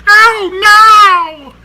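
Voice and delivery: very high, drawn-out robot catchphrase wail; loud, hammed-up high robot shriek. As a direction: squeal a mock cartoon cry of alarm. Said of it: "guess what she says a little too often".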